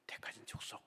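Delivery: voiceless whisper. Real, not acted. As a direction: breathe it out. Whispered recap of verse.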